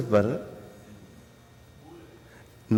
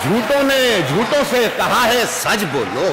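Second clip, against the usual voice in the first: poetry recitation; 7 semitones higher than usual. Acted. Poet repeats couplet.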